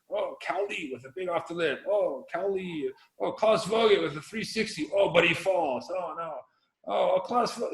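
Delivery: lightly voiced running contest commentary; imitated live ticker commentary. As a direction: react to each ticker update with "oh". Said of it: "watching the live ticker".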